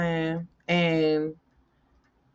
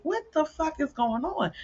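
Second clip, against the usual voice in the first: higher, softer startled cry; a mock panicked what's happening; mild mock alarm at sudden sickness; alarmed disbelief; 10 semitones higher, 4 dB quieter than usual. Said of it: "And I'm like".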